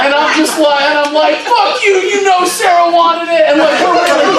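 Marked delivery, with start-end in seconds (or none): 1.4-3.5 high, hammed-up angry retort; theatrical mock anger. Teen staging a fight with his buddy, theatrical anger. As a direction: play the staged fight to the hilt. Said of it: "really putting the theater in there".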